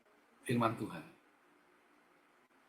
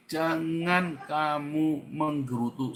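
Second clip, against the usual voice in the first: usual speaking voice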